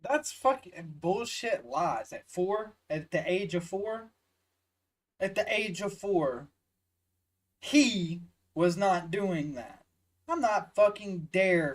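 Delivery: loud, slow, outraged disbelief; furious outburst. Real, not acted. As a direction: spit it out in real outrage. Reacting in outrage to clip.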